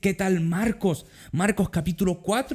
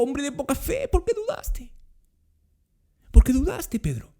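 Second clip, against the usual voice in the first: tender; 7 semitones higher than usual. Shown as a demonstration. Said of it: "Palabras cariñosas".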